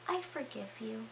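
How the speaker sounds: soft, chillingly gentle murmur; hushed forgiveness. Retold or imitated. Storyteller voices Howard forgiving Emmett before shooting, hushed. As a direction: say it very quietly, almost whispering.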